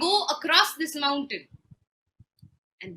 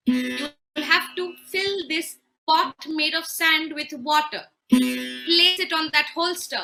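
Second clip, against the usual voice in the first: louder stern command; stern orders; 4 semitones higher, 6 dB louder than usual. Acted. Husband piling on demands for his wife, sternly. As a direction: spell out the demands firmly.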